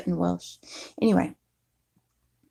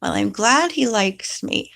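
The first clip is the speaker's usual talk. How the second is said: normal talking voice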